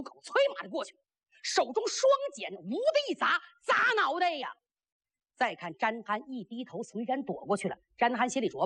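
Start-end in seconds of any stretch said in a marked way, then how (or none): none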